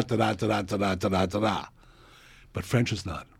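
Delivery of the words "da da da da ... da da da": evenly stressed da-da beats, strong-weak; tonic accent rhythm. Shown as a demonstration. Teacher demonstrating English stress with da-da syllables. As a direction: tap out the da-da beat like a metronome. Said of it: "are all based on the tonic accent".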